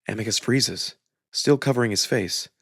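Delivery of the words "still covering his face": ordinary delivery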